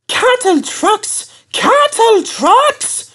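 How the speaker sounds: very high, slow squeaky chant; high mocking coach voices; grumbling coaches. A narrator's reading. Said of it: "grumbled the coaches".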